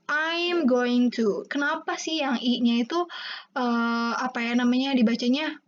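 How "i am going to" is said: slow I am going to with I said as in eye; slow English model reading. Shown as a demonstration. Teacher modelling I am going to slowly for students. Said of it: "dibacanya I gitu".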